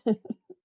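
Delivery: quick high loud laugh; a short sharp cackle. Real, not acted. Streamer laughing at his own joke, sharp laugh.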